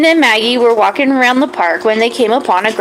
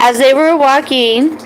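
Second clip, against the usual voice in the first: ordinary delivery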